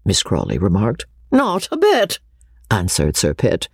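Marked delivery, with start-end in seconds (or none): 1.3-2.2 high-pitched, cheerful dismissal; good-humoured old baronet; cool good humour. A narrator's reading. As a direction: brush it off with a grin, pitched up. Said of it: "with a cool good humour".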